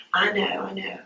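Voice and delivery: retold in a hushed, fading voice; soft reassurance. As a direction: quietly imitate soothing someone upset. Retold calming of crying man.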